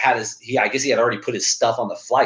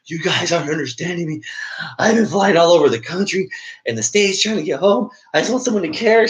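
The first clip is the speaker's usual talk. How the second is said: mock-tearful, higher-pitched plea rising at the end; imitating crying girl; 6 semitones higher than usual. Stranded traveler pleading with airline staff, imitated crying. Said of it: "this girl right here is crying".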